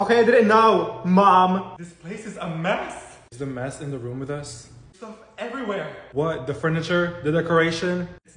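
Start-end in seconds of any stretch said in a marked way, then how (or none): 1.8-3.2 disapproving mom. Acted. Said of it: "you're turning into Mom".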